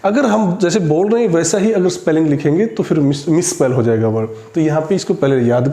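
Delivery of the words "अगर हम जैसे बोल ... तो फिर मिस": usual speaking voice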